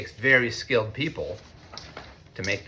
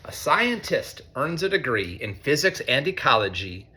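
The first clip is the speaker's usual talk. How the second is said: storybook rhyme, pitched higher and brighter; reading to children; 5 semitones higher than usual. A narrator's reading. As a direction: lift the rhymes for a young audience. Reads picture book to students.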